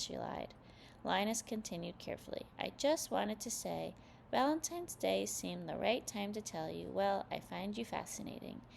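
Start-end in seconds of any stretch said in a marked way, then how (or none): none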